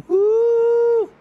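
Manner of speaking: petit cri très aigu de surprise; ouh aigu. Real, not acted. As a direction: pousse un ouh tendu dans les aigus. Réaction à un échange. (ouh de surprise très aigu)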